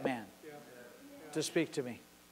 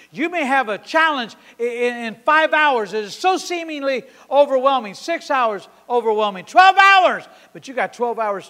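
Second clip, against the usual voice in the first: building to a high, loud shout; preach; 4 semitones higher, 7 dB louder than usual. Acted. Preacher listing hours of overwhelming challenge, building to shouted climax. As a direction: pile up the phrases to a shouted climax.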